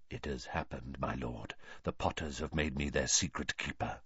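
very soft, low muttering; low excited mutter. A narrator's reading. Servant confiding to his lord, low mutter. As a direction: mutter it low, like a secret report. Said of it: "a low, excited mutter".

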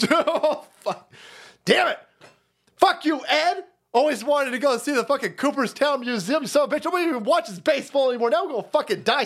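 high, frantic hammed-up panic; mock panicked pilot. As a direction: squeal it like a doomed pilot.

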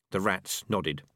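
usual speaking voice

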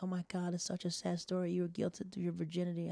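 soft, high-pitched cooing; imitated sympathetic girls. College girls hearing his sad story, cooing. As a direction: gush softly with pity.

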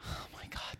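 hushed conspiratorial whisper. Acted. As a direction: whisper it like a secret. Mock-secret about absence.

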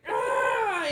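high, loud "ah"; mock wail of dread; frightened cry, played up; imitated panicked cry. Imitated panicked noise while shaving.

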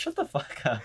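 ordinary talk, nothing marked

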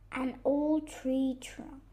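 ordinary delivery

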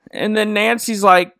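ordinary talk, nothing marked

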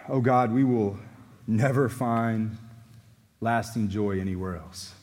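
low, earnest pastoral prayer; closing prayer. Acted. Pastor praying for his congregation, earnest prayer voice. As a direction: pray over the congregation with calm sincerity.

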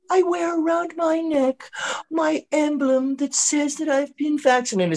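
high, slow, pious proclamation; mock preachy governor. New governor showing off her vaccine emblem, mock pious.

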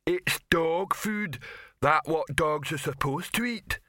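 slow, high-pitched lumbering troll; slow dim-witted troll voice. Acted. Detritus the troll speaking.

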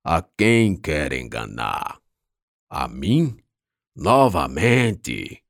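slow guttural rasping voice; deep rasping growl. Acted. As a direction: growl the questions slowly. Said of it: "Uma voz gutural".

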